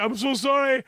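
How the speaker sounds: high, pleading mock apology; distraught apology. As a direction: act out a mortified, panicky sorry. Acting out the mortified man apologising.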